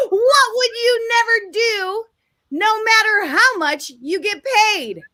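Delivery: high, drawn-out game-show delivery; big question announcement. Real, not acted. Host posing the question to the audience, grandly announced. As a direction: pitch it up and stretch it out for the audience.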